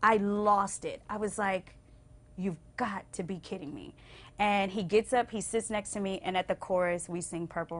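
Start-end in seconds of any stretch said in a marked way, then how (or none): 2.4-3.9 overwhelmed. Retold or imitated. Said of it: "I cried".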